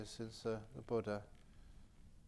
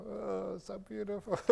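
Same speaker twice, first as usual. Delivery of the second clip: higher, choked-up gush of wonder; a mock-teary sigh of awe, pitched higher; 8 semitones higher than usual. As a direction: let the tears of joy catch the words. Overcome at the statue, crying.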